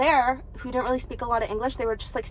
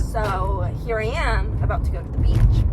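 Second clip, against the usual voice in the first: ordinary delivery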